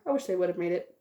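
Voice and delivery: usual speaking voice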